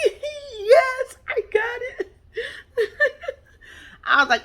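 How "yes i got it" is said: very high, laughing squeal of joy; giddy squeal. Retold or imitated. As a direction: squeal it out through laughter like a thrilled fiancée. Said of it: "she was just like".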